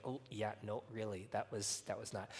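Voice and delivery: soft, low, hesitant disapproval; gentle correction. Retold or imitated. Wife telling her husband it was not fine, gentle and quiet. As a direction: imitate a tactful wife quietly objecting. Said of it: "she knows the tone of voice".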